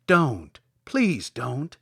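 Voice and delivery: gently hammed-up plea to spare the deer; begging. Tin Woodman begging the lion not to kill a deer, mild pleading. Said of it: "begged the Tin Woodman".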